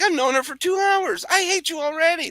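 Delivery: high, strained grumbling voice; hammed-up whiny complaint; mock jealous stranger. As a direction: whine like a man whose night was ruined.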